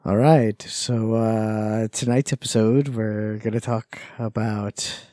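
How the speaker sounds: low, slow sultry voice; slow and seductive; sexy voice. Acted. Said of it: "It needs to be louder".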